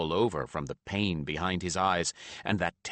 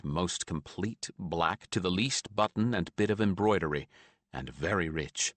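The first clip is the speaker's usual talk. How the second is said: plain reading voice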